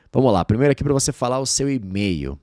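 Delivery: usual speaking voice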